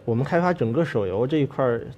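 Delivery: ordinary delivery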